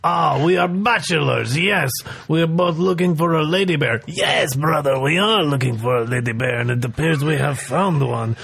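woo her as a smarmy bear; seductive owl bear. Acted. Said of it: "you are throwing your voice".

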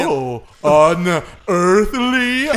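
slow, high, stretched unearthly; otherworldly alien drawl; space accent. Shown as a demonstration. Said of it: "That's my, that's my space accent".

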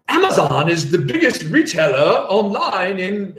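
badly faked Australian ad voice; amateur mock Australian accent; horrible Australian accent. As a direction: imitate an Australian advert, badly. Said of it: "excuse my horrible Australian accent".

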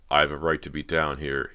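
plain reading voice

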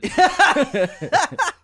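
loud squealing laughter, very high; high shrieking laugh. Real, not acted. Laughing at friend's putting joke, high loud shriek.

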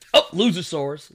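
usual speaking voice

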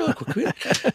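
ordinary delivery

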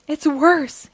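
mild high shout of dismay. A narrator's reading. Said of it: "he shouts".

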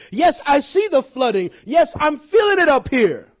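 loud, high, insistent preacher; full-force preaching. Acted. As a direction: build it like a preacher in the storm.

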